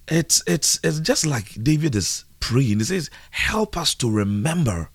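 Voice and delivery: high, drawn-out preaching with long pauses. Acted. Preacher telling listeners time is not theirs, preaching. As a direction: preach slowly, swelling on the key words. Resumes preaching after radio check.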